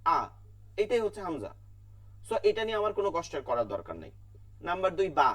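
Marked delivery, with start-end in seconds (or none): 0.0-0.2 আ said as a plain open vowel; hamza vowel demo. Shown as a demonstration. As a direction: demonstrate আ for the class. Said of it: "আ কি হইল?".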